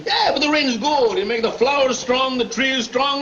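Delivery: ordinary delivery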